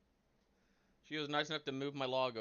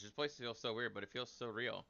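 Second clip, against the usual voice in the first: ordinary delivery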